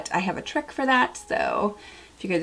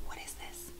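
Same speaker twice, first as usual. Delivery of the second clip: whisper; 22 dB quieter than usual. Real, not acted. Scoffing at tiny bottle.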